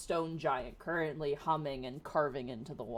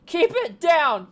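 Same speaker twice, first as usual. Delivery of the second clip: loud, high and slow shout; 12 semitones higher, 16 dB louder, slower than usual. Acted. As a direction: bellow the words. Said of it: "he said calmly".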